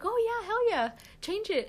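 high-pitched, keen retold agreement; excited quote of herself; eager agreement. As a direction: retell an eager yes, pitched well up. Acting out her eager agreement.

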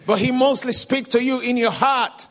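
forceful, projected teaching; loud preaching. Retold or imitated. Preacher pointing the crowd to the heart, loudly. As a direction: press the point home loudly.

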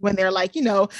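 usual speaking voice

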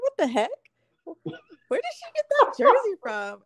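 high, loud mock disbelief; acted-out bewildered outburst, pitch leaping up; baffled. Cheerleader retelling her puzzlement at the jersey, baffled.

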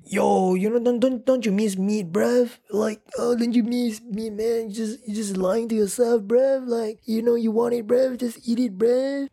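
hammed-up London roadman impression; mock roadman accent. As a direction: tease them in a put-on London street accent. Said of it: "I mean".

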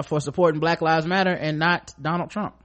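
ordinary delivery

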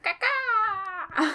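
high squawking caw-caw; eagle call imitation. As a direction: screech like a bird of prey. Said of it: "what a bald eagle actually sounds like".

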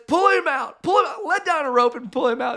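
high, urgent, happy shout; excited high-pitched hollering; overjoyed command. Acted. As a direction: cry out orders in joyful relief. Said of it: "he's so happy".

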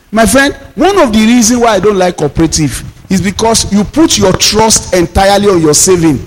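ordinary talk

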